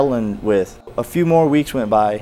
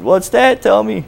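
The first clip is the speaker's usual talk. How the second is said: eager surprise; 8 semitones higher, slower than usual. Retold or imitated. Eager reply to pastor.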